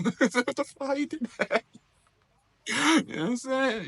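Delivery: high, laughing what the fuck; laughing disbelief. Retold or imitated. Laughing at a baffled player, high squeal.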